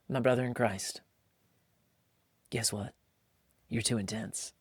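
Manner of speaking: soft, deadpan verdict to a friend; quiet blunt truth. Retold or imitated. Bluntly telling dad the truth.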